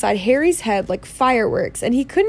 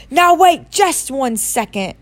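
slow, raised-pitch outrage; thundering; 4 semitones higher, 4 dB louder, slower than usual. A narrator's reading. Hagrid outraged at what Harry doesn't know, thundering. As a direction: stop everything, slowly and indignantly. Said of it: "Hagrid thundered".

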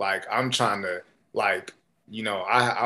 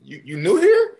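probing greeting; 11 semitones higher, 7 dB louder than usual. Retold or imitated. Retold approach to the new stranger.

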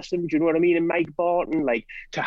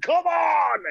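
high, shouted rallying cry; a mock bloke firing everyone up; rowdy shout; 12 semitones higher than usual. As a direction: gee the room up like a rowdy bloke. Bloke getting the room energized.

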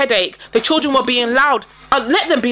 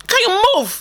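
a touch higher, impatient brush-off; curt Karen retort; 8 semitones higher than usual. Retold or imitated. Retelling telling the Karen to move, high curt voice. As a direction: shoo her away with mock impatience. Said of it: "telling me I'm rude".

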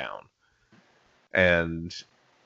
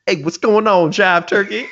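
mock jive voice; 11 semitones higher, 5 dB louder than usual. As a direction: ham up a clueless dude's jive greeting.

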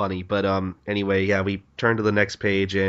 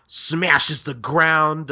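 ordinary talk, nothing marked

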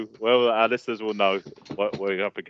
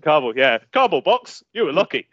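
hammed-up Yorkshire old man, higher; Yorkshireman voice; 5 semitones higher, 5 dB louder than usual. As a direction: do the Monty Python Yorkshireman. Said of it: "four Yorkshiremen sketch".

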